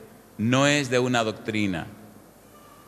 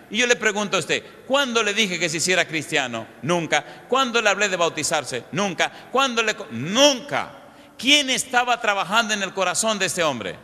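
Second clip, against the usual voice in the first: raised, pressing sermon voice, driving each question home; fervent preaching; 5 semitones higher than usual. Acted. Preacher pressing the congregation with questions, fervent and raised.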